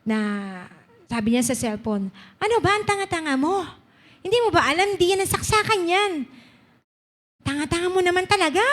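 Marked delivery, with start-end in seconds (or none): 2.4-8.6 high-pitched imitated rant, fast at the end; angry phone scolding. Co-teacher scolding his mother over the phone, imitated angry rant. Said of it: "galit na galit siya".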